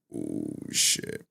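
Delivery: low breathy gasp of shock; shocked ooh. Real, not acted. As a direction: recoil at the news. Reacting to shocking chat.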